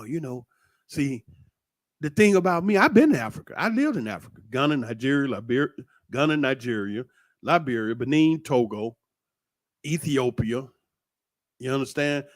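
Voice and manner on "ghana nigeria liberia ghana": normal talking voice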